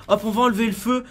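ordinary delivery

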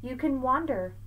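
lively sing-song picture-book read; reading to children. Acted. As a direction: read Seuss aloud to a child, bouncing the rhymes. Reads Dr. Seuss aloud.

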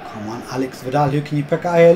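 usual speaking voice

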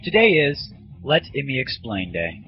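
normal talking voice

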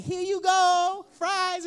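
put-on slow, high little-kid offering; proud toddler. Dad voices his son proudly handing over leaf fries, high and slow.

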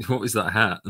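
ordinary delivery

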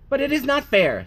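a hint of a put-out little frog; frog child protest. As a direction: complain like a small frog kid. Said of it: "said Little Frog".

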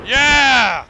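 loud triumphant yeah; excited cheer. Real, not acted. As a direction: cheer the kill. Celebrating a kill.

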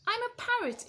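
high, loud squawk; squawking parrot. Acted. Parrot introducing itself to George, squawking. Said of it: "it squawked".

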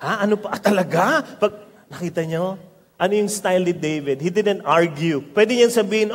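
usual speaking voice

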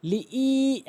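লিই with the ee held long; elongated vowel. Shown as a demonstration. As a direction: stretch the ee of লিই for one alif. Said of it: "টেনে পড়তে হবে".

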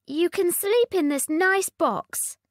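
high sweet princess; coaxing little princess; cartoon princess. A narrator's reading. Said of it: "said the princess".

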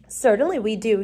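ordinary delivery

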